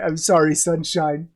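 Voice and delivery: usual speaking voice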